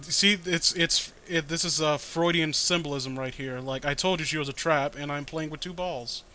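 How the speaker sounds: ordinary delivery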